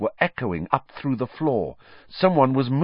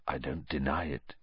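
low, hushed admission; very quiet; 8 semitones lower, 11 dB quieter than usual. A narrator's reading. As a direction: speak very softly and low. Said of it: "he said very quietly".

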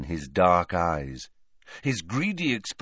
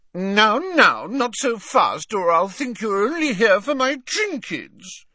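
pouting; 13 semitones higher, 5 dB louder than usual. A narrator's reading. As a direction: sulk playfully at him. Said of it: "pouted Hepzibah".

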